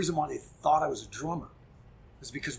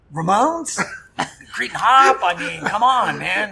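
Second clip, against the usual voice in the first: exasperated plea; 7 semitones higher, 16 dB louder, slower than usual. Retold or imitated. Pleading with unresponsive drummer.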